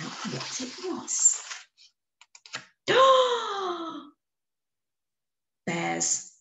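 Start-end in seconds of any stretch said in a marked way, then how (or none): 2.9-4.0 high sharp gasp; gasp of discovery. A narrator's reading. Amelia realising Norman is a bear, sharp gasp. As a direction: it suddenly clicks.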